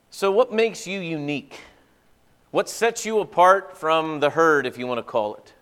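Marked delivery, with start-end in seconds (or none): none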